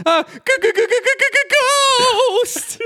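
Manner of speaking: terrified, very high cartoon stutter; cartoon scaredy-cat yelp; Scooby and Shaggy. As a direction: stammer on the first sound, then squeal the word out. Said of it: "Scooby and Shaggy simultaneously say".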